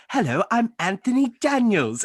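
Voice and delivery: high-pitched, formal droid greeting; Anthony Daniels impression. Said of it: "did an Anthony Daniels impression".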